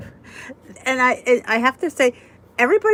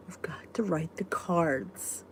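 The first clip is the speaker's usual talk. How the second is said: a slow, soft aside whispered to herself; 4 semitones lower, 12 dB quieter, slower than usual. Real, not acted. Whispering a reminder to herself about the cards.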